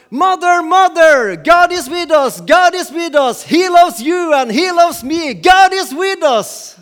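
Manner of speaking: put-on a little boy calling out to his mother; shouting. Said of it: "og så roper han".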